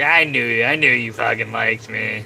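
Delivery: loud, crowing imitation of a dog; hammed-up, loud I-told-you-so; mock triumph. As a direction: burst out in playful gloating. Voicing dog's triumphant reply.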